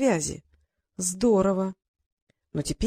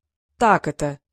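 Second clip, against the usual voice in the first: mocking; 4 semitones lower, 5 dB louder, slower than usual. A narrator's reading. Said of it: "Марк насмешливо хмыкнул".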